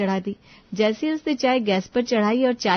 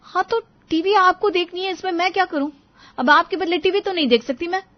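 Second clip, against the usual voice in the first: higher, irritated retort; exasperated; 9 semitones higher, 5 dB louder than usual. Acted. Wife retorts about husband's TV, exasperated. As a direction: throw it back at him, fed up.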